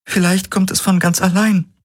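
high voice, sadly certain; Key, sad and sure. Acted. Key tells Galen he will forget her, high and sad. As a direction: tell him gently he will live on.

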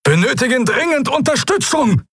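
very high, desperate shriek; captain screaming for support through a projection; shrieking for help. Acted. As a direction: screech the call for backup. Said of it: "kreischte die Projektion".

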